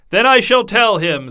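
booming merry king; king proclaims to the table, loud and jolly. A narrator's reading. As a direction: announce it with a flourish. King announces boldly.

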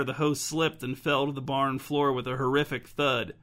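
plain reading voice

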